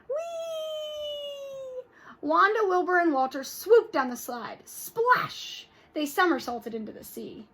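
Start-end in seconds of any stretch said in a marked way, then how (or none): 0.1-1.8 much higher gleeful squeal; whee. Acted. Sliding down ice slide. 4.7-5.7 a higher breathy splash sound. Acted. Splashing into sea.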